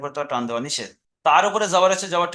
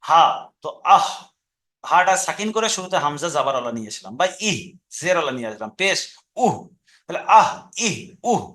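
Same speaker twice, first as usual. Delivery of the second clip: throat sound demo. Shown as a demonstration. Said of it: "যেমন".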